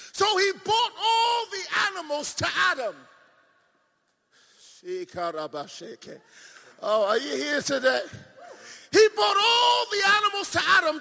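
loud pulpit proclamation; fiery preaching. Acted. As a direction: hammer the point home to the church. Preaching at full force.

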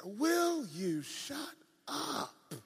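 very slow, stretched-out words; drawn-out rebuke. Retold or imitated. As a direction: imitate an exasperated God, word by word. God voiced rebuking the speaker.

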